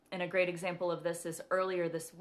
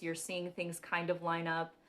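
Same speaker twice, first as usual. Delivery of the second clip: ordinary talk, nothing marked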